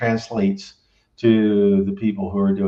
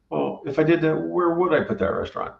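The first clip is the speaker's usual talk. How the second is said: pitched much higher, musing to himself; mock musing in a raised voice; wondering aloud; 7 semitones higher than usual. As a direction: float it up high like a daydream. Imagining self, planning restaurant.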